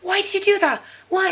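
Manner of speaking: put-on high, loud frustrated yell at a child; re-enacting shouting at her son in frustration; exasperated shouting. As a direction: let the frustration burst out. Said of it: "you're just like".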